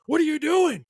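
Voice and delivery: high, loud panicked shout of surprise; startled yell. Retold or imitated. Coworker startled by a girl rushing past, high panicked yell. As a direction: yell it like someone bumped you from behind. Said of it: "He's freaking out".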